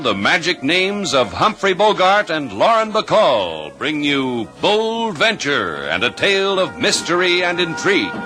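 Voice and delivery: ordinary talk, nothing marked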